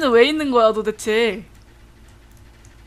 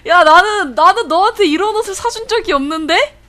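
indignant squeal; 6 semitones higher, 7 dB louder than usual. Real, not acted. Indignant at pet's outfit.

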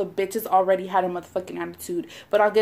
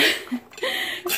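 breathy giggle; 8 semitones higher, 5 dB quieter than usual. Real, not acted. Laughs at own fantasy.

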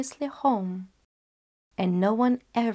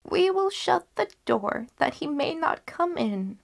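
a higher, lightly voiced old woman; resolute grandmother; 9 semitones higher than usual. Grandmother telling the girl to lock the wolf out, high and firm. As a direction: settle it firmly, old and sure.

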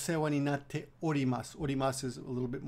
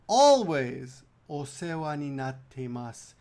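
slow model phrase; 6 dB louder, much slower than usual. Shown as a demonstration. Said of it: "So from Maggie Sensei".

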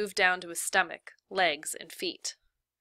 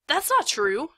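protest; 8 semitones higher, 6 dB louder, slower than usual. Acted. Stanley protests the warden's lie.

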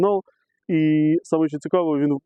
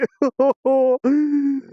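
long, high-pitched laugh of delight; elated laughter; 11 semitones higher, 3 dB louder than usual. Real, not acted. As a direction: let out a squealing happy laugh. Overjoyed at the coin find.

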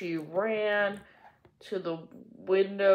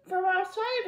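much higher, piping voice; little girl; 13 semitones higher than usual. A narrator's reading. Pollyanna explaining the flies, high piping voice. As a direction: innocent child's reply.

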